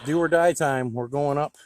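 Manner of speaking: normal talking voice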